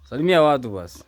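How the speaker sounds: loud call toward the house. Real, not acted. Son calling his mother out to greet viewers, loud call. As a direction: call her over from inside.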